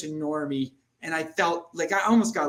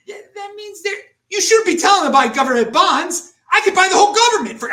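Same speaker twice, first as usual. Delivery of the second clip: much higher and louder, fast at the end, nearly shouting; wild rant; 10 dB louder than usual. Real, not acted. To the host about government bonds, a loud runaway rant. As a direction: let it run away into a boast. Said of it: "sound like a psychotic liar".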